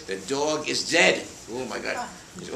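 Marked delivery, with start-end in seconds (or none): none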